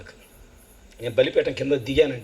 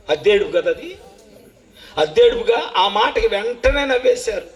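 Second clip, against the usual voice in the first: usual speaking voice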